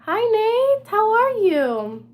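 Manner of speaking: very high, loud sing-song hello; hammed-up shouted greeting. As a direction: call out to Nate in a big cartoon voice, dragging it out. Loud exaggerated greeting to Nate.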